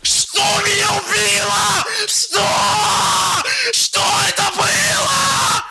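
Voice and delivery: loud frustrated yelling; angry shout. Real, not acted. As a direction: yell it out in frustration. Streamer loses a fight, yelling.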